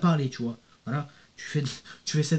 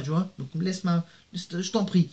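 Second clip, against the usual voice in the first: usual speaking voice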